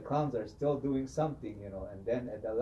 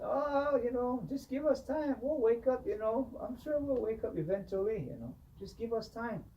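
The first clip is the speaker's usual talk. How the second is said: high, wheedling plea for more time; clown pleading; 12 semitones higher than usual. Retold or imitated. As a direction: beg sheepishly in a high voice. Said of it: "the chief clown will say".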